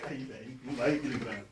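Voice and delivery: hushed whisper so he won't wake; breathy whisper, barely audible. Real, not acted. Friend asleep, pranking quietly.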